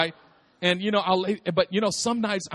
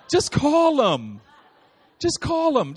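put-on pleading child; 6 semitones higher, slower than usual. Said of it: "And she goes".

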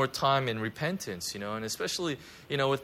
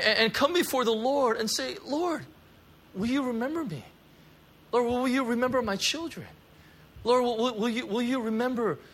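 fervent prayer; 7 semitones higher than usual. Acted. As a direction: plead with God at full force. Leads congregation in prayer.

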